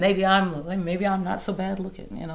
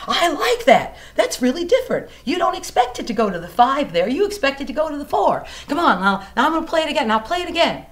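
much louder, higher urging voice; eager friend; 8 semitones higher, 8 dB louder than usual. Retold or imitated. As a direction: push her to grab her guitar. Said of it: "he goes".

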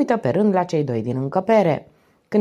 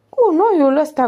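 higher, louder offended repeat; indignant echo; 10 semitones higher, 6 dB louder, slower than usual. A narrator's reading. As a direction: throw the insult back at him. Toto repeats the insult indignantly. (repetă jignirea indignat, mai sus și mai tare)

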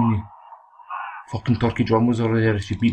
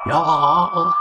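victory shout. Real, not acted. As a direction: roar it like you just won the belt. Wins the boxing fight.

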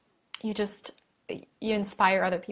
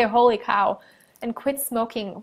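ordinary talk, nothing marked

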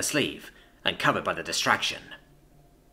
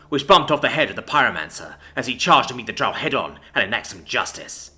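ordinary delivery

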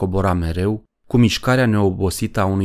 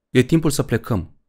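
usual speaking voice

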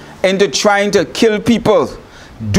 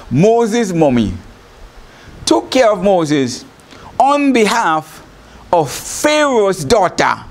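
ordinary delivery

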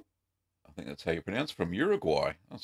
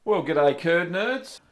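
loud cheery greeting; 8 semitones higher, 3 dB louder, slower than usual. Shown as a demonstration. Practising the show greeting.

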